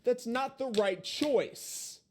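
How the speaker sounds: mocking quote. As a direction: draw it out like a smug excuse. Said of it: "He tried to use that excuse".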